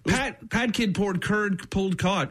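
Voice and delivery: slow, deliberate; careful slow attempt; slow careful try. Shown as a demonstration. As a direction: set each word down slowly. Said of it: "it's a little hard".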